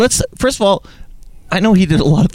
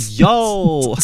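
toned-down yo; 4 semitones higher than usual. Pretend. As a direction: try the yo again more casually. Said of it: "I'll run this game on you".

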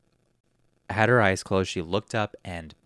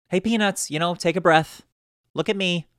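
pitched-up, louder sing-song coaxing; high coaxing teacher tone; 9 semitones higher, 5 dB louder than usual. Retold or imitated. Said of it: "kinda with that tone too".

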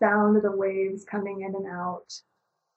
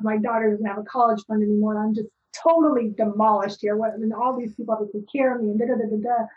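angry inner rant; 3 semitones higher, 4 dB louder, much faster than usual. Retold or imitated. Reliving her spiralling angry thoughts, a fast loud exasperated rant. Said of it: "I just couldn't stand that noise".

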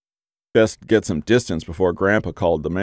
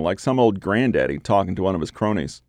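plain reading voice

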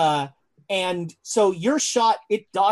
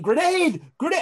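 mock yelling; 9 semitones higher than usual.

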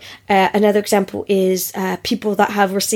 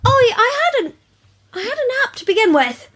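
high protest; 13 semitones higher than usual. Real, not acted.

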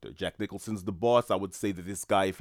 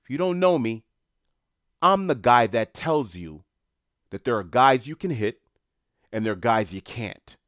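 intimidating mobster; 3 semitones higher, 6 dB louder than usual. Retold or imitated. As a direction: lean on him like a Boston enforcer. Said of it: "Mister French responds and says".

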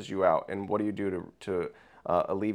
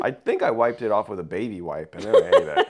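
laughing; 6 dB louder than usual. Real, not acted. Confessing gross habit, laughing.

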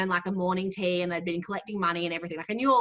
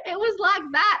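high, cracking voice about to cry; on the verge of tears; 10 semitones higher, 6 dB louder than usual. Shown as a demonstration. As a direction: let the voice break like you might cry. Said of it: "a croaky voice and you feel like you're about to cry".

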